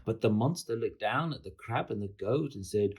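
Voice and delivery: ordinary delivery, nothing marked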